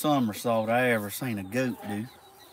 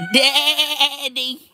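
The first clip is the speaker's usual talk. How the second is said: high bleating daddy; a high goat cry of daddy; imitating a goat calling; 11 semitones higher, 4 dB louder than usual. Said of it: "He goes".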